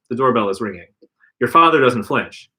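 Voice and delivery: ordinary delivery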